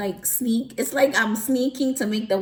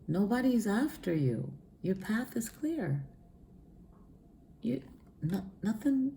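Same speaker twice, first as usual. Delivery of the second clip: softer, reassuring angel voice; calm them down gently; 6 dB quieter than usual. Retold or imitated. Angels reassuring her on the couch, soft. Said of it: "was like".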